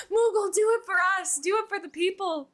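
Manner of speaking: high pleading coax to the cat; pet talk voice. Real, not acted. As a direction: beg the cat playfully. Coaxing cat on camera.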